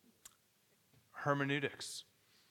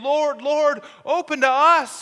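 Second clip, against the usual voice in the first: pleading cry; 8 semitones higher, 7 dB louder than usual. Acted. Foolish virgins plead at the door.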